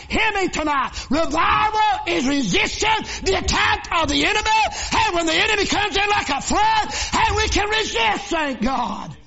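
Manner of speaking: shouted, high-pitched preaching climax; full-force preaching. Acted. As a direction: preach it loud and high over the music.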